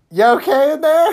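high squeaky impression, laughing through it; high mock voice. Friend retelling movie, squeaky high concerned voice. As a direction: play the guy calling into the chipper, high and amused. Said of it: "he's like".

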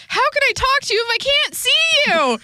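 high, loud whiny little-sister yell; hammed-up high yell; mock yell as Dawn. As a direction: squeal it like a bratty teen. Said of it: "she yelled".

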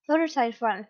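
plain reading voice

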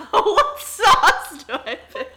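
high, loud, slow, laughing through it; laughing mock echo. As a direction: echo the silly question while cracking up. Friend repeats joke, laughing.

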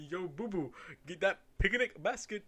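cartoon bear voice with a laugh, like a bad Jamaican accent; Yogi Bear impression. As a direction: play Yogi asking Boo Boo for the basket. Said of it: "trying to do a Jamaican accent".

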